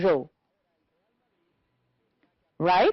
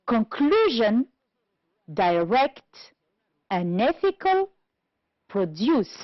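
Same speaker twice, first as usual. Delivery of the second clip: "conclusion, direct, unethical, produce" stressed on CLU, RECT, ETH, DUCE; stress on second syllable; slower than usual. Shown as a demonstration. Teacher listing words for the table, "conclusion, direct, unethical, produce" stressed on second syllables. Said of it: "On the second syllable".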